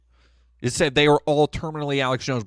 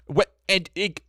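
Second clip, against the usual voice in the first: normal talking voice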